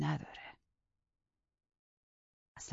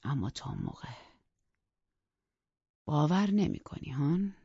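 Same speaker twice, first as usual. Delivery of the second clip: plain reading voice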